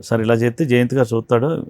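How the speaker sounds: ordinary talk, nothing marked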